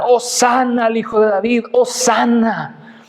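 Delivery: high acclamation, drawn out on the last word; mock cheer of a crowd, not at full volume; retold crowd shouting. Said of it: "y le gritaban". (aclamación de multitud, imitada)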